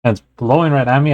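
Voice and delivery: ordinary delivery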